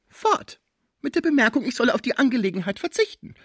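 very high, agitated woman's reply; indignant outburst. A narrator's reading. Madame tells the lawyer they have gone, high indignation.

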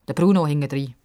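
usual speaking voice

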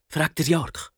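plain reading voice

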